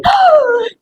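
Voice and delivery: long, very high cry of shock; shocked gasp, re-enacted. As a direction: re-enact a mother's horrified gasp. Mother's shock at the smack. (grito agudo de susto, recreado)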